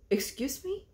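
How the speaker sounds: affronted question. Retold or imitated. Partner to the insulting provider, affronted question. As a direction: ask it as if daring a repeat.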